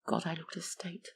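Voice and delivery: near-whispered dismay; hushed aside. A narrator's reading. Narrator recoiling at the mirror, hushed aside. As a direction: drop to a whisper, privately appalled.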